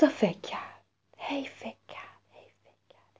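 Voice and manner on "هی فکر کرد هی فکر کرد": softer and softer whisper; fading whisper. A narrator's reading. Fading thinking repetition.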